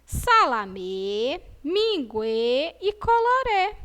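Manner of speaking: SALAMÊ, MINGUÊ, COLORÊ each stretched on the final Ê; slow, held closed Ê endings; drawn-out word list. Shown as a demonstration. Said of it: "as palavras que nós encontramos".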